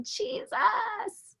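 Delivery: calling out the name high but held back; retold cry out. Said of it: "I just cried out".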